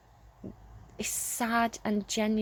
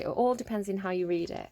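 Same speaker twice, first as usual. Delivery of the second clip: usual speaking voice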